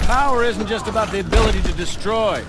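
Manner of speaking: formal sales pitch. Acted. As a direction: project it like a CEO showing off his army. CEO presents Atlas to recruit.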